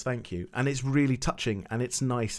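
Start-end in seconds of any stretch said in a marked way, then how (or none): 0.0-0.5 soft thanks. Retold or imitated. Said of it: "just says".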